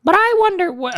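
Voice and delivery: very high put-on voice; character voice. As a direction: pitch it right up for a comic character. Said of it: "I don't know whose voice this was".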